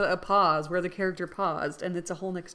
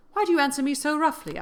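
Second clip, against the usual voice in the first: indignant; 9 semitones higher, 6 dB louder, faster than usual. Acted. Indignant reply to rude stranger.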